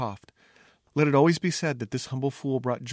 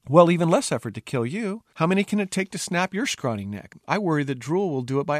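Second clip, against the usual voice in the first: higher, louder, needling princess; scornful retort; 6 semitones higher, 5 dB louder than usual. Acted. Princess threatening her scrawny fool, scornful. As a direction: jeer at him in a raised pitch.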